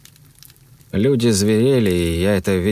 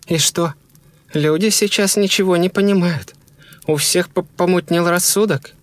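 high, insistent woman's voice; shaken wife; 11 semitones higher than usual. A narrator's reading. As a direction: argue in a high voice, stumbling slightly.

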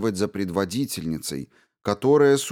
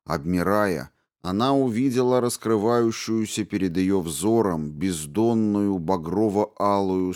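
ordinary delivery, nothing marked

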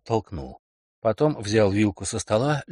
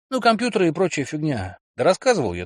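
put-on higher, pushy young man; eager boyfriend voice; 6 semitones higher, 4 dB louder than usual. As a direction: insist brightly, a bit defensive. Kelly voiced.

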